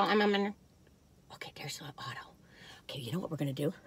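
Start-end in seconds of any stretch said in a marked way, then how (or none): 1.3-3.7 hushed whisper, much softer. Real, not acted. Showing little Otto to viewers, soft whisper. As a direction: breathe it out as a secret.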